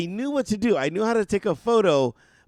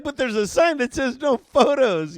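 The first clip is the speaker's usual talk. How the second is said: higher and louder, panicked objection; flustered protest; 6 semitones higher, 5 dB louder than usual. Retold or imitated. Fan protesting to a friend that photos are banned, flustered. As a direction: blurt out the rule in a panic. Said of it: "I'm like".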